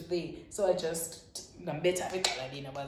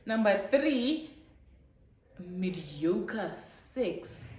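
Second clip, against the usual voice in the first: slow punchline; much slower than usual. Retold or imitated. Punchline of text reply.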